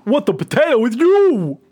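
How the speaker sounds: a hint of an Italian-American gangster; mock mobster, high and loud. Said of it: "Italian-American gangster sort of thing".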